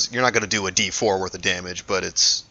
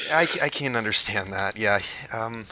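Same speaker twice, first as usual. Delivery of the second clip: hesitant Eliza; 5 semitones higher, 3 dB louder than usual. Acted. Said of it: "she says".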